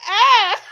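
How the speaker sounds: loud high cry of someone knocked over; a sudden yelp from the ground; startled yelp. Retold or imitated. Girl knocked down cries out.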